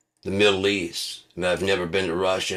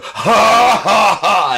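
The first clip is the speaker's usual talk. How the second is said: loud high mocking "ha, ha"; mocking laugh; 13 semitones higher, 12 dB louder, much slower than usual. Real, not acted. Comic laughing at the televangelist's claim, loud and high. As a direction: laugh it off in scorn.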